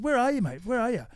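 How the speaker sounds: retold impatient call, pitched high. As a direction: demand where he is, half-joking exasperation. Impatient phone call to friend.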